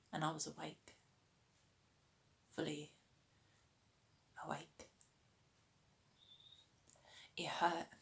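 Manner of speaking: hushed, halting delivery with long pauses; subdued, pained recollection. Acted. An addict recalling waking in hospital without drugs, subdued. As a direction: let each painful phrase hang, softly.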